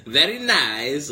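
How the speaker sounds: put-on slow comedy foreign accent with a chuckle; lightly hammed-up Borat-style accent. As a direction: draw out both words in a mock accent. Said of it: "my foreign language one is just Borat".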